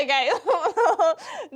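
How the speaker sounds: a high, laughing okay; embarrassed laugh. Retold or imitated. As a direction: laugh the word out, mortified.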